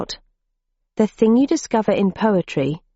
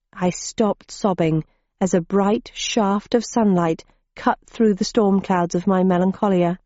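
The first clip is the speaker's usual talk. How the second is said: plain reading voice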